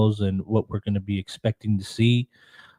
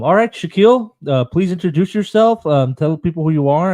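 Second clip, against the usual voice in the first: raised voice calling a name; 6 semitones higher, 8 dB louder than usual. Real, not acted. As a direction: call out to get his attention. Calling guest who is silent.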